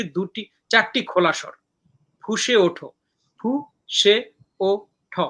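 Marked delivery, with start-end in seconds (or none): 3.4-5.2 four separate beats, ফু, সে, ও, ঠ; syllable by syllable. Shown as a demonstration. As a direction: clear pause after each of ফু, সে, ও, ঠ. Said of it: "চারটা syllable মিলে একটা পর্ব".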